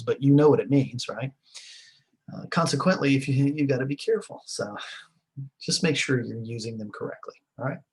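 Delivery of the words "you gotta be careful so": ordinary delivery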